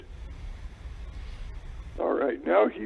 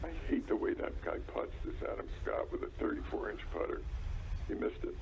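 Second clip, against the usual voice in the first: whispering; 11 semitones lower, 14 dB quieter than usual. Real, not acted. Quietly griping while watching golf.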